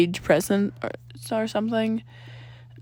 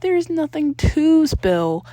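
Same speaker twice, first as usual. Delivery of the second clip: embarrassed denial; 6 semitones higher, 6 dB louder, much slower than usual. A narrator's reading. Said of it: "blushing, looking at the table".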